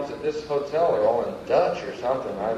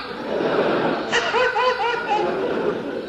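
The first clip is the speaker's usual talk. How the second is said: high squealing laugh. Real, not acted. Big laugh.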